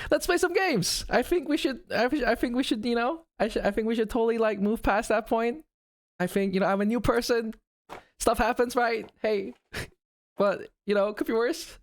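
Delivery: sudden very high, cutesy chirp; perky streamer voice. Pretend. Pretend canceled streamer moving on to games, high and perky. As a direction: flip up high as if all is forgotten.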